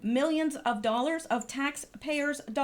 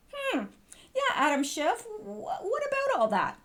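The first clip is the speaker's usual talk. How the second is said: ordinary talk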